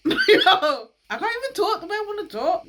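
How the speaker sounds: high, fast, loud laughing rush; giddy outburst. Real, not acted. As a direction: blurt it out, half laughing.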